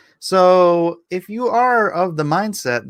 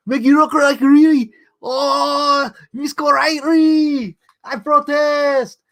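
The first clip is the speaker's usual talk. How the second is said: higher, slower caricature voice; mock cartoon voice pitched up and slowed; 8 semitones higher, slower than usual. Impression of racist cartoon voice.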